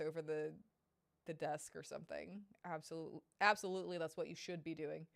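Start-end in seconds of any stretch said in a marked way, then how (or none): none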